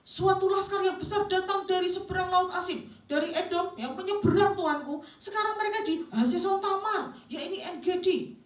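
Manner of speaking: high, loud, urgent alarm; panicked messenger. Acted. Messenger warns his king of an invading army, panicked. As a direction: dramatize a frantic report at high pitch. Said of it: "itu panik".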